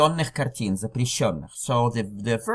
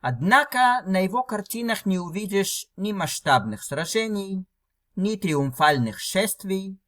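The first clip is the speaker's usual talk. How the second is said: reading aloud; 7 semitones higher than usual. Shown as a demonstration. Reads the Russian text aloud.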